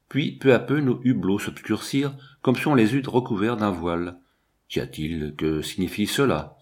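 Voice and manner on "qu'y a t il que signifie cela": plain reading voice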